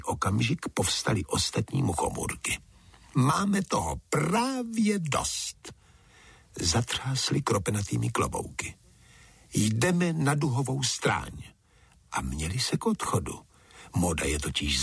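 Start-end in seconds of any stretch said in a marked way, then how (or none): 3.1-5.7 very high, drawn-out acted protest; storybook indignation, slow and high-pitched; indignant outburst. A narrator's reading. Indignant toadstools rise in revolt. 9.5-11.4 high-pitched, huffy declaration; acted defiance, voice pitched up; defiant announcement. A narrator's reading. Toadstools defiantly announce leaving.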